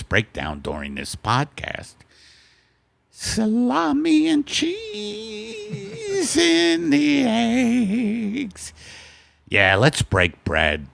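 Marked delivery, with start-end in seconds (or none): 3.1-8.7 much higher falsetto, drawn out slowly; sing-song it like a wife serving breakfast. Acted. Wife announcing breakfast to Al, in a slow high falsetto. Sketch, Margaret character voice.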